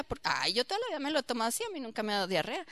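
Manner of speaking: rushed quiet brush-off; fast dismissive. Retold or imitated. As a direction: hurry through a careless excuse. Voicing stubborn dismisser.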